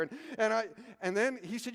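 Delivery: usual speaking voice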